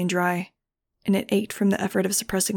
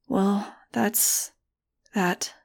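shaky; much slower than usual. A narrator's reading. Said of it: "I said shakily".

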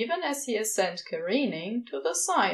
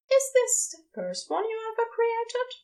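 higher, curious angel voice; Aziraphale; 14 semitones higher than usual. A narrator's reading. Angel asking the demon about his creation, curious and higher. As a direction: lift into a lighter, curious voice. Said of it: "Aziraphale asks then".

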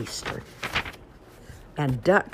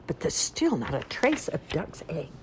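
usual speaking voice

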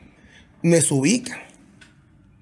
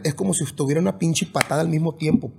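normal talking voice

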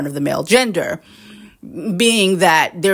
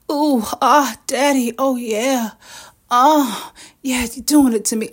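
mock moans; 5 semitones higher than usual. To a lover, faking it in bed, hammed-up moans. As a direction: fake moaning in bed, high and breathy. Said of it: "absolutely performative".

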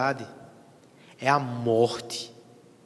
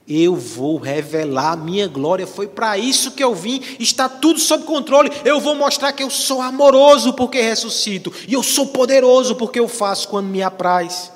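preached divine declaration; 6 semitones higher, 8 dB louder than usual. Acted. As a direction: build to a loud, high proclamation. Voices Christ's declaration while preaching.

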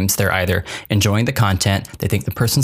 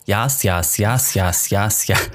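robot voice; slower than usual. Retold or imitated. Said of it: "her little robot voice".

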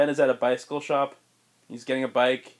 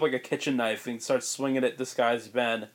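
ordinary talk

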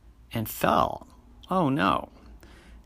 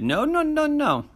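genie. Acted. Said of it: "And the genie said".

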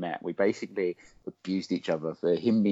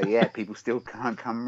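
ordinary delivery

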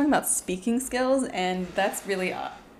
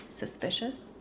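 usual speaking voice

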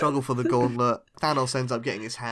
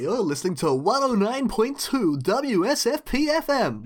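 radio DJ voice; 9 semitones higher than usual. Acted. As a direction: hype it like a DJ. Doing a radio DJ ident.

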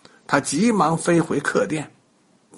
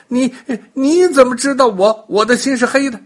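stammering in fright; 8 semitones higher, 5 dB louder than usual. A narrator's reading. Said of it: "结结巴巴地说".

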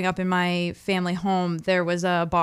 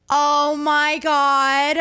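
higher, long drawn-out realization; imitating mom; 5 semitones higher, much slower than usual. Mom downstairs suddenly realizing, imitated drawn-out exclamation. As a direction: stretch it out like a mom calling from downstairs. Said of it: "I hear my mom downstairs go".

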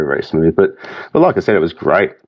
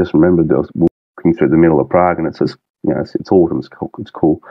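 usual speaking voice

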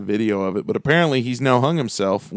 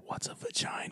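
hushed, clueless whisper; mock-confidential whisper; 20 dB quieter than usual. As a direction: lean in and whisper the question. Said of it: "he just leaned over and whispered".

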